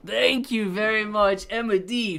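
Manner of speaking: loud, high, slowed donor shout-out; hyped thank-you. Real, not acted. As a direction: announce thanks big and stretched out.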